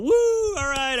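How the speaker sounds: high whooping cheer; mock cheer. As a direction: whoop it up for the kid. Crowd cheering a kid.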